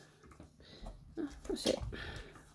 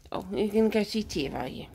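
ordinary talk, nothing marked

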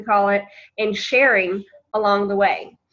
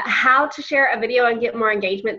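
ordinary delivery

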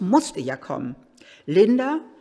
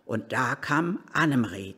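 ordinary talk, nothing marked

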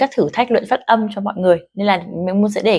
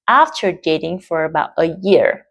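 slowly with stress on "dating" and "year"; slow model reading; 2 semitones lower, slower than usual. Shown as a demonstration. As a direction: read slowly and clearly. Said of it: "Có được cái phần nhấn ở đấy". (đọc mẫu chậm rãi)

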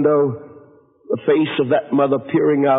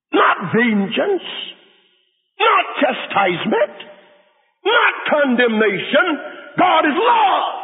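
preaching climax; 9 semitones higher, slower than usual. Acted. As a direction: build each line to the final shout. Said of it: "The preacher said".

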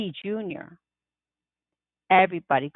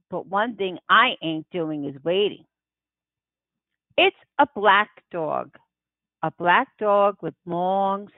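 ordinary delivery, nothing marked

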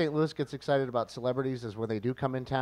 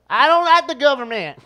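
loud, high outburst; hillbilly rant; 12 semitones higher, 9 dB louder than usual. Pretend. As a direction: shout it like a grumpy hillbilly.

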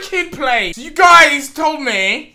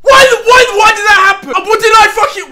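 high loud wailing protest; anguished yell; 4 semitones higher, 5 dB louder than usual. Real, not acted. Gamer cheated by the game, high anguished shout. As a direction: wail at the unfair game. Losing unfairly.

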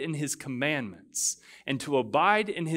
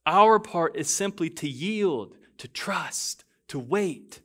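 higher, louder impassioned preaching; preaching at full force; 6 semitones higher, 4 dB louder than usual. Acted. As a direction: carry the room with fervour.

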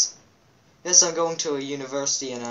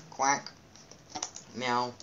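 ordinary talk, nothing marked

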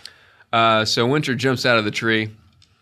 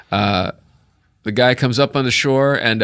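ordinary talk

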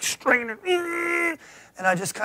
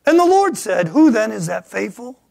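scripture reading; 5 semitones higher, 10 dB louder than usual. Acted.

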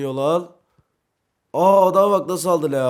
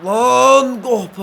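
frustrated cry; 8 semitones higher, 7 dB louder, much slower than usual. Real, not acted. Shot missed.

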